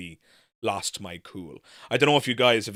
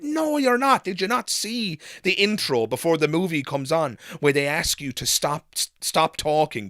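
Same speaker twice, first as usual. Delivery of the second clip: raised, much higher voice, heated and scolding; retold angry rant; 5 semitones higher, 4 dB louder than usual. Telling off the talkers behind him in the cinema, heated and high. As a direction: pitch jumps up to tear into them.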